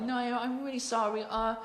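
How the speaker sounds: high-pitched mock New York accent; put-on apologetic New York woman. Woman offering Alfred coffee, high New York accent. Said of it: "very thick New York accent".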